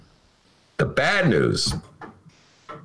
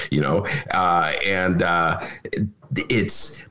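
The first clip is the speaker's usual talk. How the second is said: ordinary delivery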